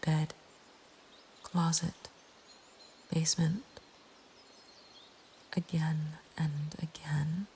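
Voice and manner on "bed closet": ordinary delivery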